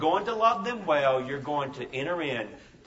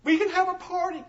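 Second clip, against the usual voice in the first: put-on high, small child's voice, wondering; little boy's hopeful question. Preacher voicing the crying boy, high and childlike. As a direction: sound like a teary five-year-old asking. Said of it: "he looks up and he says".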